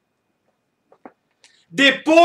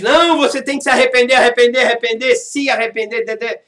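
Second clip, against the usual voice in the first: mock nagging; faster than usual. Mocking everyone's repeated advice.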